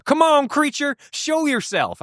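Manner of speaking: high-pitched yell in a put-on country accent; hammed-up yokel accent. Idiot friends hanging out car windows, yelling in a hammed-up country accent. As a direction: holler it way up high in a silly backwoods accent. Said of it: "I don't know why I'm giving them that accent".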